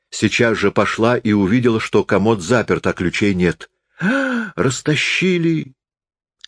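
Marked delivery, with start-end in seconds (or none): 4.0-5.7 high gasp, then slow drawn-out cry; alarmed cry. A narrator's reading. Arina discovers her keys are missing, cries out in alarm. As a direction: gasp sharply and wail it slowly, high.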